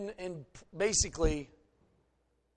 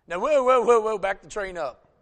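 loud, higher mock alarm from Moses; alarmed whoa; 3 semitones higher, 9 dB louder than usual. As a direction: pull back, startled and loud. Moses balking at God's call.

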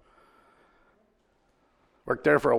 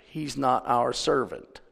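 ordinary delivery

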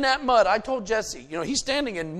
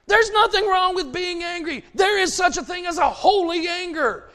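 loud, higher fiery sermon; preaching at full force; 5 semitones higher, 5 dB louder than usual. Real, not acted. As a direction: fire up the congregation.

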